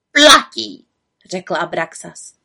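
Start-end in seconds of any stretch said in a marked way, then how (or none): 0.1-0.9 loud raven voice, clinching the deal; gruff raven deal. A narrator's reading. Raven accepts the witch's offer firmly. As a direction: accept like sealing a bargain.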